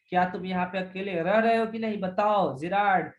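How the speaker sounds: ordinary delivery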